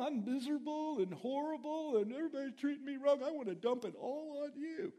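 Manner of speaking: preacher imitating a complaining person, whiny; mock whiner. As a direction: whine it out like a miserable grump. Mocking a complainer.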